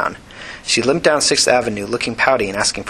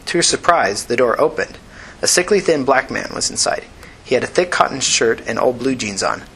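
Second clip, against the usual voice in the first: usual speaking voice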